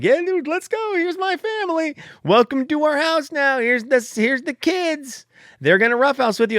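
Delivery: high, bubbly, overeager welcome voice; a cheery squeaky welcome to the new puppy; mock cheerful. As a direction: sing it out like a clueless eager host. Voicing his own cheerful self to dog.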